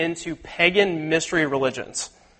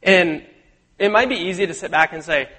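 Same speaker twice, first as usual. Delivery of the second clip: ordinary delivery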